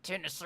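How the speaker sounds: "Tennessee" retried awkwardly, a little high; retry "Tennessee" as if it sticks in your mouth; awkward pronunciation attempt. Shown as a demonstration. Streamer disliking how Tennessee feels to say, awkward attempt. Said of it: "when I try and say that".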